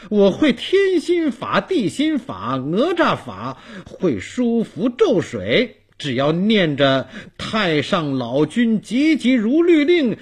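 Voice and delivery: high, loud self-encouraging boast; loud, emphatic bragging building to the spell; boastful bravado. Acted. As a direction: puff yourself up against the ghosts. Old man boasts to himself, reciting spell.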